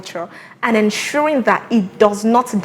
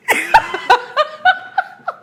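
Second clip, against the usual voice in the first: long high laugh; amused host laughing; high burst of laughter. Real, not acted. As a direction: let out a real laugh. Host laughs at guest's choice.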